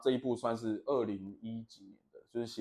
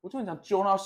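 ordinary talk

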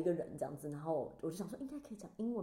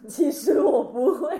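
loud high wail through laughter; comic mock-tearful admission; mock-dramatic confession; 7 semitones higher, 15 dB louder, slower than usual. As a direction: drag it out, laughing at yourself. Mock-dramatic punchline about not speaking French.

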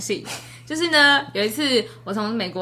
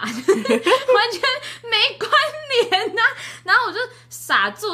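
high laughing; 12 semitones higher, slower than usual. Real, not acted. Laughing while telling.

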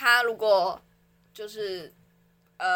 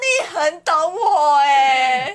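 slow, loud gushing cry; drawn out delighted wail; mock swooning; 5 semitones higher, 8 dB louder, much slower than usual. Joking she describes him.

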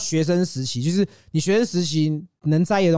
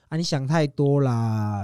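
1 slow dismissive quote; 3 semitones lower, slower than usual. Retold or imitated. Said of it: "他就会去讲说".

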